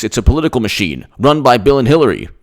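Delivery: ordinary talk, nothing marked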